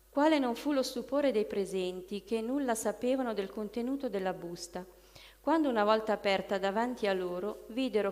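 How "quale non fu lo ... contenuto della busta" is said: plain reading voice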